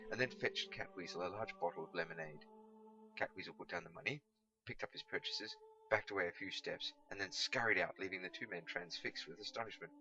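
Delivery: usual speaking voice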